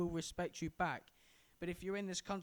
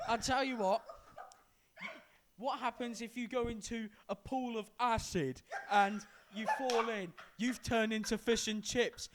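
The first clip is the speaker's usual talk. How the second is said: mock teasing friend; 3 semitones higher, 9 dB louder than usual. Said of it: "That's like saying".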